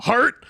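HEART swooping sharply up, loud; voice going up. Retold or imitated. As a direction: shout HEART high like a question. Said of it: "his voice goes up".

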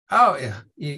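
usual speaking voice

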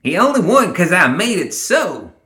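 high, dismissive character voice; snorted. A narrator's reading. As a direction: scoff at his win in a high voice. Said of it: "Ma Tucket snorted".

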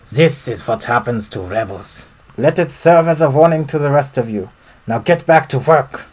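menacing German villain voice; German accent. Acted. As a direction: foreman warning miners in a German accent. German foreman threatens rebels.

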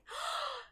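high, sharp mock gasp; overplayed gasp of alarm; dramatic gasp. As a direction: gasp in hammed-up shock. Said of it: "she's like".

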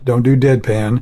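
normal talking voice

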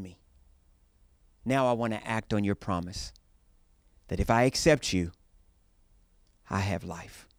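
earnest, steady prayer voice. Acted. Leads a prayer.

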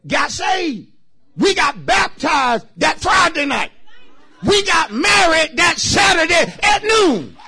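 high, loud shouting testimony; fiery sermon shout; preaching at full force. Real, not acted. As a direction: whoop it up for the congregation.

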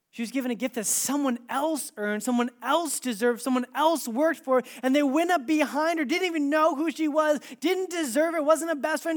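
building from level voice to high, loud insistence; impassioned preaching. Real, not acted. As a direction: pile up the phrases with rising force. Preaching builds to full force.

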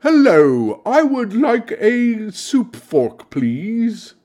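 high, slow, prim society lady; eloquent debutante. Pretend. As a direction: put on a prissy debutante voice. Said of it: "I speak like an eloquent debutante out on the town".

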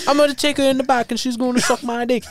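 put-on high-pitched, off-target British accent; bad British accent sliding toward Jamaican. Singer boasting to the boyfriend, badly faked British accent. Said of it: "sound like [name]".

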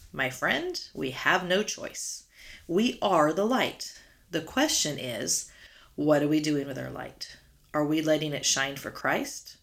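ordinary talk

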